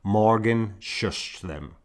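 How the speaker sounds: ordinary delivery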